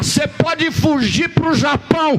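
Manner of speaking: high, slow, shouted preaching; fiery preaching. Acted. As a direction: preach it out with full force. Preaching at full force. (pregação inflamada e lenta)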